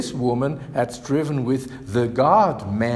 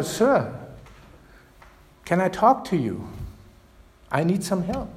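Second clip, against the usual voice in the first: a slightly louder, pleading repeat; a little louder; 4 semitones higher than usual. Retold or imitated. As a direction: ask again, a bit louder and higher. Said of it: "says again a little louder".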